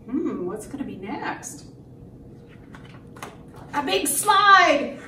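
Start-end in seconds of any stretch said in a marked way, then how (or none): 3.7-5.0 loud, raised, drawn-out reveal; dramatic announcer. Acted. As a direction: call out the next challenger. Announces dinosaur's opponent to children.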